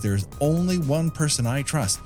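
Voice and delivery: ordinary talk, nothing marked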